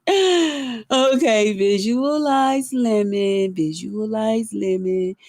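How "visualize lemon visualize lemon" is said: slow, dreamy visualizing voice; meditative focus. Acted. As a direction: chant it slowly to summon him. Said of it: "let me get into my lemon frame of mind".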